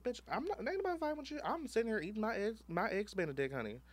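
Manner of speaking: very high, sassy diva; camp diva voice. Retold or imitated. Telling off the queen at brunch in a very high, sassy diva voice. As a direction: swoop up like an outraged diva.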